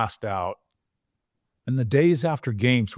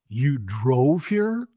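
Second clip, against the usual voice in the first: slow, drawn-out disbelief; incredulous doctor; much slower than usual. Retold or imitated. Neurologist to injured player who drove, slow disbelief. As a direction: stretch it out like you can't believe it.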